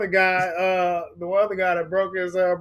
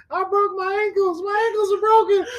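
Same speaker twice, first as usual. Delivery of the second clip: mock wail; 12 semitones higher than usual. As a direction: cry it out like the hurt guy. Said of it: "Pointing at his".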